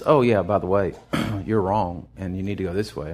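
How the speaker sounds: low, soft, matter-of-fact correction; gentle voice of the Lord. Retold or imitated. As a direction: quietly set him straight like a patient Lord. The Lord reminding the preacher.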